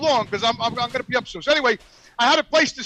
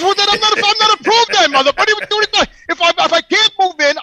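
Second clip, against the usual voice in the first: louder and higher, exasperated complaint; indignant retold protest; 5 semitones higher, 5 dB louder than usual. Retelling the landlord call, exasperated and loud. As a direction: replay the outrage at the rental lady.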